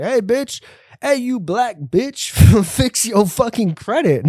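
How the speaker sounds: high, slow hammed-up catcall yell; exaggerated pretend heckler, drawn out and high; mock street heckler. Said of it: "I wasn't standing on the street being like".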